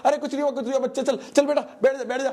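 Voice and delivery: high, hurried soothing to cover embarrassment; a grandfather quickly calming a screaming child in public, pitched high; high, anxious coaxing; flustered grandfather hushing. Retold or imitated.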